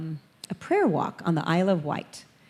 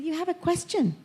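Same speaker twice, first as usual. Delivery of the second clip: gentle coaxing; 10 semitones higher than usual. Retold or imitated. Speaker coaxing shy girls.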